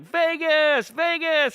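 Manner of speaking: high, loud celebratory chant; acted hyped-up party cheer; Swingers scene. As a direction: whoop it like guys hitting the town. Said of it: "that scene in Swingers where they're like".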